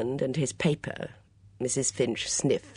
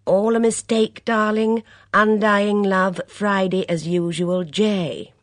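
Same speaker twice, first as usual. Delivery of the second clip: louder, slow mock-tender read; reading sentimental ad; 7 semitones higher, 7 dB louder, slower than usual. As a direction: savour the lovers' note. Reading love notice aloud.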